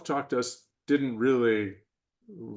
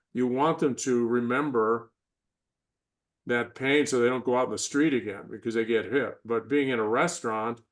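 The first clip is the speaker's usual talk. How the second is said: usual speaking voice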